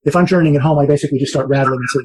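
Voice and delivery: normal talking voice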